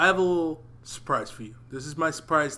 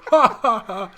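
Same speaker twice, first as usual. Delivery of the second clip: high hammed-up villain cackle; maniacal laugh; 7 semitones higher, 8 dB louder than usual. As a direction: mad villain cackle, loud. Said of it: "that's my maniacal laugh".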